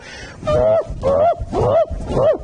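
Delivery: big hooting laugh falling off; gorilla laugh. Retold or imitated. Preacher imitating his dad's big laugh, hooting gorilla laugh. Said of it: "Like a gorilla".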